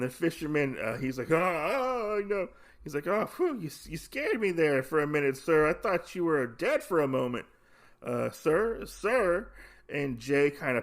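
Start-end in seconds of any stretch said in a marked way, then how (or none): 1.3-2.5 high mock fright, oh no; startled fisherman. 3.1-9.5 high, nervous relieved fisherman. Retold or imitated.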